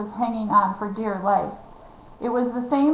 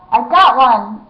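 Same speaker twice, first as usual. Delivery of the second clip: plain reading voice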